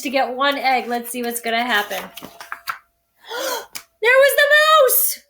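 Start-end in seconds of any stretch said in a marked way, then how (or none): 2.1-2.8 breathy gasp. A narrator's reading. Reveal in storybook. 4.0-5.2 loud, high exclamation of discovery; spring it on the kids; surprised reveal. A narrator's reading. Reading to children, loud surprised reveal.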